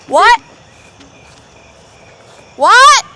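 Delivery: high, loud startled What; sudden shocked double take; surprised. Real, not acted. Shocked at something found.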